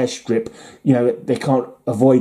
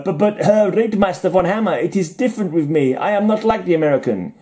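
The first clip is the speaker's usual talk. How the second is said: put-on higher-pitched German-accented pilot; put-on German accent, raised pitch; mock German accent; 6 semitones higher than usual. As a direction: protest in a hammed-up German accent. Acted German pilot talking to his commander.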